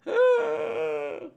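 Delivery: high, long drawn-in gasp; shocked gasp. Acted. Crew shocked by the castaway's power, long gasp.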